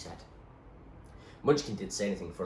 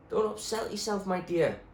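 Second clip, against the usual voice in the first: plain reading voice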